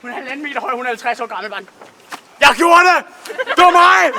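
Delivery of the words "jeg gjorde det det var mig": loud, high mock yell of pride; imitating mom's triumphant yell; proud shout. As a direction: shout it like a proud little kid. Mom boasting about scaring fish.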